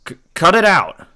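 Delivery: loud, high stammering protest; stammered shout. Acted. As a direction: burst out trying to stop the bullies. Boy steps in against punks.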